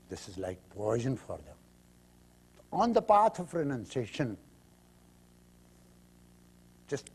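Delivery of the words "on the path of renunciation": normal talking voice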